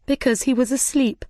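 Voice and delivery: ordinary delivery, nothing marked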